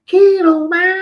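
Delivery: high booming name callout; announcer shout. Pretend.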